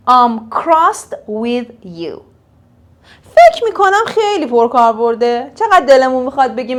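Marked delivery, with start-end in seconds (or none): 0.1-2.2 very slow, clear I'm crossed with you; slow model. Shown as a demonstration. Teacher modelling for students, very slow. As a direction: spell it out word by word. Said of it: "teacher models phrase slowly".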